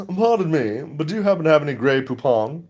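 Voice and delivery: high, put-on advert voice; old commercial character. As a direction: parody the mustard commercial's polite request. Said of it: "Remember those old commercials?".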